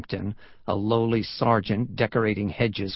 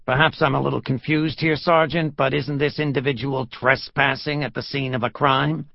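raised-pitch, pointed mock puzzlement; sarcastic rebuke; 3 semitones higher than usual. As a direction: feign confusion to rebuke a subordinate. Lieutenant sarcastically rebukes his sergeant.

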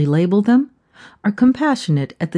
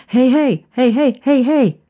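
shouted calls; 6 semitones higher than usual. Retold or imitated. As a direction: call out hey sharply, again and again. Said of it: "Shouts from the armed guards".

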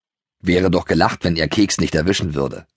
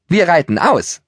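higher, delighted cheer; girl cheering happily about a ride, higher; joyful cheer; 10 semitones higher than usual. Acted. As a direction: cheer it like a girl thrilled to go riding. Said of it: "jauchzte Greta".

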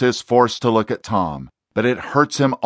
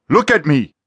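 higher, louder snap; sharp order; 6 semitones higher, 5 dB louder than usual. Acted. Exorcist demanding the demon look at him, sharp. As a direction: demand the eyes now.

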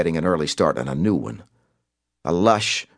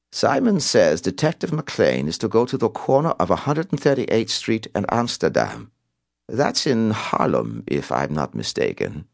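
put-on raised, lilting European-accented villain; Simon, European accent; 5 semitones higher than usual. A narrator's reading. Caller sends McLean to Harlem, European accent. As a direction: give the orders teasingly, European accent. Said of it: "recited in a European accent".

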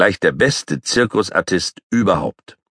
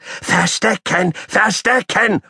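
high and slow, drawn-out squeal; sing-song pestering call; slow squeaky call; slower than usual. Acted. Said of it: "ruft Paule schon wieder".

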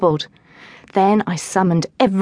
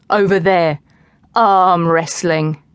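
slow challenge; 4 dB louder, slower than usual. A narrator's reading. Will issues the challenge.

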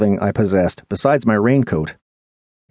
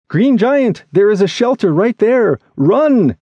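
high, raised call to run for cover; urgent shout; 10 semitones higher, 3 dB louder than usual. Acted. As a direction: cry out an urgent warning. Urgent call to flee to shelter.

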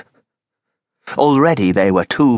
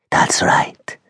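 whispered woman's voice. Acted. Lola's voice remembered.

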